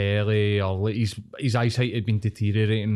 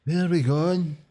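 higher-pitched mock German accent; attempted German accent; 6 semitones higher, slower than usual. Lost tourist asking the way at Charing Cross, imitated German accent. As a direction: put on a rough German accent. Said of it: "In a far-, a foreign accent".

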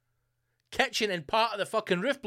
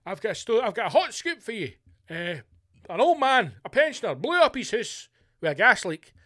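higher, louder mock scoop pitch; eager tipster; 5 semitones higher, 6 dB louder than usual. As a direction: act out breathlessly selling a hot story. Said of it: "But somebody's going".

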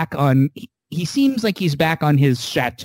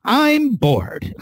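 mock whine, pitched high; whiny bored complaint; 10 semitones higher than usual. Recapping Jurati complaining to Rios, mock whiny. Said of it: "And she's like".

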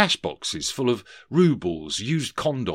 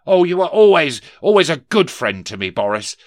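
high, loud, gushing start, easing off; gush, then settle toward the name; effusive Scrooge; 8 semitones higher, 9 dB louder than usual. Acted. Scrooge flatters his old partner, effusive.

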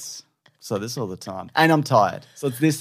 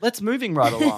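higher teasing repeat of let's moving right along; mock echo; 6 semitones higher than usual. Husband teasing wife's slip, a higher mock echo. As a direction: parrot "moving" back at her, amused. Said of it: "echoes her phrase".

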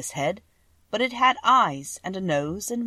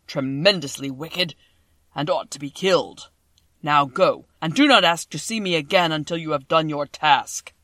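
voice of the great Oz; 8 dB louder than usual. A narrator's reading. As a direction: boom each word out like a huge magic head.

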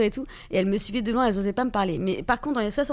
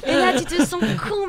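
high loud laughing, slower words; 6 semitones higher, 5 dB louder, slower than usual. Real, not acted. Retelling an awkward moment.

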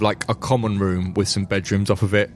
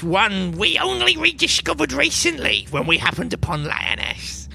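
much higher, serious little gnome voice; gnome spymaster Silas; 8 semitones higher than usual. Acted. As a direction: explain the secret gravely, tiny and earnest. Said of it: "He says".

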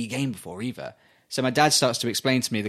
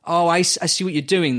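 dawning realisation; 5 semitones higher, 5 dB louder than usual. Retold or imitated. Player grasps the camera idea.

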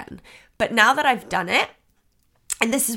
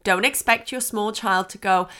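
ordinary talk, nothing marked

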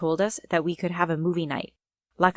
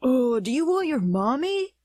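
put-on higher-pitched baby-talk taunt; mocking; 6 semitones higher than usual. A narrator's reading. A boy to Charlotte after the video, mocking. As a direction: tease with mock pity. Boy mocks a humiliated girl.

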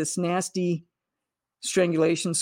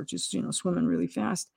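normal talking voice

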